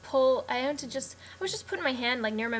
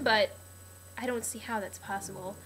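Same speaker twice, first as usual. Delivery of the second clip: usual speaking voice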